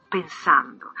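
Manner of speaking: ordinary talk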